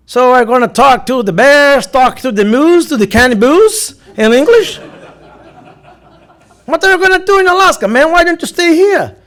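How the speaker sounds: a joking American officer ribbing a traveler; immigration officer teasing. Retold or imitated. Officer teasing the speaker about talking to bears, slow amused. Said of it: "Aí ele olhou pra mim e disse".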